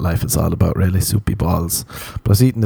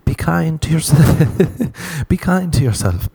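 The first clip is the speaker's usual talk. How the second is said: high, slow, sugary self-help coo; 8 semitones higher, slower than usual. Retold or imitated. Mocking American self-help advice, high slow coo. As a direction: coo it like a gentle wellness guru. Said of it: "They'll be like".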